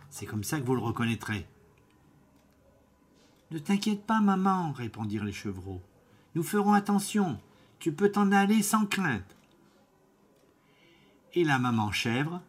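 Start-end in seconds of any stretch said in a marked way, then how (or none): 3.5-4.7 put-on high, light voice of young goat kids; sweet little goats reassuring their mother; little kids' voice. Young goats reassuring their mother, in high little voices. 6.3-9.4 put-on high, light voice of young goat kids; sweet little goats reassuring their mother; little kids' voice. Young goats reassuring their mother, in high little voices.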